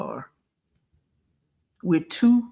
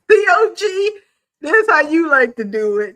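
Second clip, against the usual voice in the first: loud, high mock wail; hammed-up crying; 8 semitones higher, 6 dB louder than usual.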